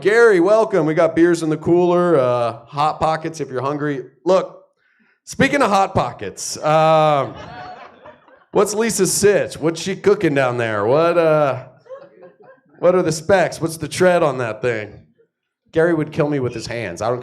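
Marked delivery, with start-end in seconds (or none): 0.0-4.0 hosting buddy voice. Pretend. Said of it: "I'm like". 4.2-15.1 sheepish awkward ask. Pretend. Awkwardly asking friend about wife.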